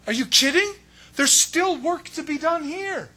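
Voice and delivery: high, loud disbelief, slow at the end; incredulous protest. Retold or imitated. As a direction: scoff at the plan in shock. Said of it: "It's like".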